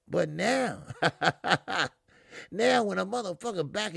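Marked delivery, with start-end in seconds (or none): 0.9-1.9 real amused chuckle in a quick run; laugh. Real, not acted. Host amused at a boxer's changed talk, laughing. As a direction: laugh it off mid-point.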